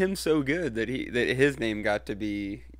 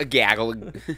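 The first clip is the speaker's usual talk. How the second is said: put-on Boston accent; 3 semitones lower, 5 dB louder, slower than usual. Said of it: "with a Boston accent".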